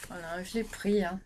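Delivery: usual speaking voice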